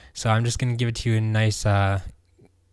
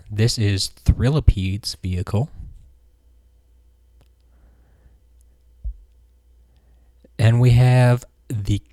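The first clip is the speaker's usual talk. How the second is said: showcase announcer; 6 dB louder than usual. Acted. Presenting figures over slideshow.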